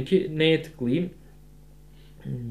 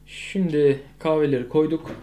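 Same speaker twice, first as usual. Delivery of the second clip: ordinary talk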